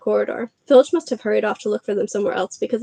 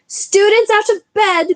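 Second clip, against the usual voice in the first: high, slow, loud bellow; 11 semitones higher, 6 dB louder, slower than usual. Acted. Peeves raising the alarm down the corridor, bellowing. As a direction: bellow it to wake the whole castle. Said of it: "Peeves bellowed".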